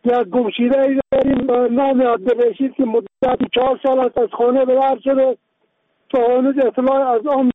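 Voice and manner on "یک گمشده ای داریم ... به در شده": ordinary talk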